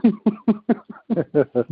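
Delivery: high-pitched burst of laughter; hearty laugh. Real, not acted. Glad never to tour there again, hearty laugh. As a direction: laugh out loud, high and unforced.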